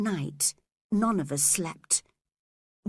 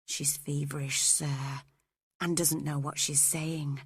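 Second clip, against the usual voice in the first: low, soft confidential murmur; whispered aside; 5 semitones lower, 5 dB quieter than usual. A narrator's reading. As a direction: lower your voice so the patient won't hear. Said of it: "I whispered".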